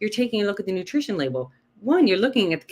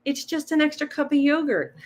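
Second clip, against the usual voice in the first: mock excuse; 8 semitones higher than usual. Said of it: "if you said".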